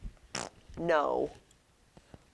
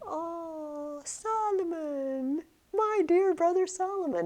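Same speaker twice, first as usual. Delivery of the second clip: higher, slow doting Miss Pross; doting sister; 10 semitones higher, slower than usual. Retold or imitated. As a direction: sigh out the brother's name lovingly, voice up high. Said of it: "a different side to Miss Pross".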